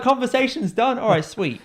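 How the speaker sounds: high-pitched retold agreement; mock-cheery wrap-up; upbeat sign-off. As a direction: act out an overly agreeable reply. Retelling a brisk, agreeable sign-off.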